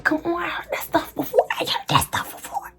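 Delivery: high-pitched, squeaky protest; exasperated squeal. Real, not acted. Mocking a suitor's familiar lines.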